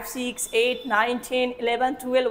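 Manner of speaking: normal talking voice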